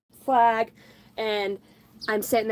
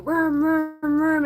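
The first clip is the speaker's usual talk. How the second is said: high vrooming dirt-bike noise; imitated engine vrooms; 6 semitones higher, 3 dB louder, much slower than usual. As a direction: mimic bikes revving in circles.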